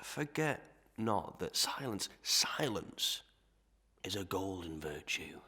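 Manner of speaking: dropping to a whisper; hushed whisper. Acted. Master whispering a warning to keep silent. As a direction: whisper it like a shared secret.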